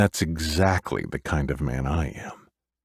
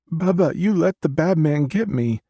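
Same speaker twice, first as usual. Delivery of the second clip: put-on high, accusing child's voice; little girl voice; 13 semitones higher, 4 dB louder than usual. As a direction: plead like a hurt little girl. Ghost child Macy accuses.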